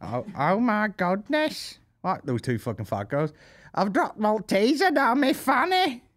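high, slow gossiping put-on voice; hammed-up Gogglebox viewer. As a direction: play a shocked Gogglebox viewer. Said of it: "everyone off Gogglebox".

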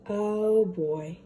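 ordinary delivery

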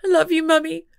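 put-on a young girl saying it through tears; tearful little girl. A crying girl tells her mum she loves her, tearful.

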